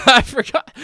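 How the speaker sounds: laughing. Real, not acted. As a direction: blurt it mid-laugh. Bursts out laughing.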